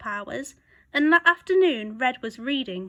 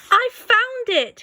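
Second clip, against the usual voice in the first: loud, high, slow exclaim; joyful shout; 11 semitones higher, 7 dB louder, slower than usual. A narrator's reading. Wolf finding the book he wants, shouting happily. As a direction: burst out with delight. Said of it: "he shouted".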